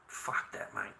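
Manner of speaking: under the breath, no voice; soft whispered brush-off; whispered aside. Real, not acted. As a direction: breathe it out dismissively. Muttered aside after rant.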